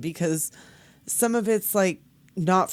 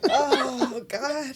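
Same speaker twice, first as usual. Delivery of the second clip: laughing; 3 semitones higher, 3 dB louder than usual. Real, not acted. Laughing at the meme.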